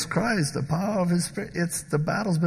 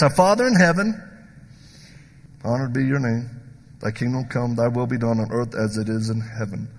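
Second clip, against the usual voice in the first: prayer reading; 4 semitones lower, 3 dB quieter than usual. Acted. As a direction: pray it aloud for the church. Reads the Lord's Prayer.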